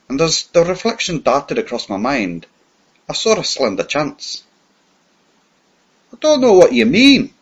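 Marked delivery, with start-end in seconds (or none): 6.1-7.3 much higher and louder, rough and defiant; rough denial. Acted. Captured spy feigning a tramp, snapping back roughly. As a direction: bark it back like a cornered tramp. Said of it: "I said roughly".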